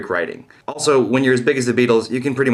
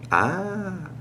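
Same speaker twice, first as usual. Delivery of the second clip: exaggerated gasp of realization; 8 semitones higher, 8 dB quieter than usual. Mock revelation sigh.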